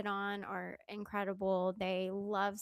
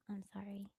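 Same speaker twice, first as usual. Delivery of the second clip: whispering; 9 dB quieter, faster than usual. Real, not acted. Whispering to the dog.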